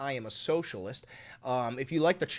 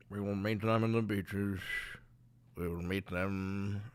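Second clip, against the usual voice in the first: Winston Churchill impression; 9 semitones lower, 7 dB quieter than usual. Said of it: "is that Winston Churchill?".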